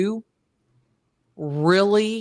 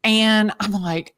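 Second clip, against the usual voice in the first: ordinary talk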